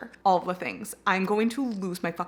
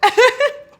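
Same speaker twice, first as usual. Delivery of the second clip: high, loud burst of laughter; high fangirl cackle; giddy laugh; 10 dB louder than usual. Real, not acted. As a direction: laugh as if losing it. Giddy over the book.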